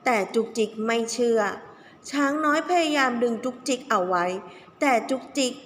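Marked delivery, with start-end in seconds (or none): none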